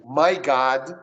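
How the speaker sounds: "My God" with an open American "ah"; model pronunciation. Shown as a demonstration. Teacher has student repeat, "My God" with open O. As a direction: model "My God" clearly, God's O wide open. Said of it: "repete comigo".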